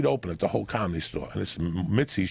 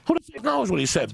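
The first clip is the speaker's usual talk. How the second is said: high, exasperated retort; 11 semitones higher than usual. Retold or imitated. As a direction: throw it back in high-pitched frustration. Acted exasperation at the guys.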